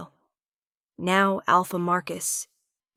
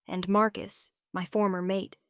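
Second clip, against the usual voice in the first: plain reading voice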